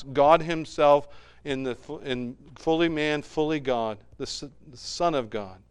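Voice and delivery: ordinary delivery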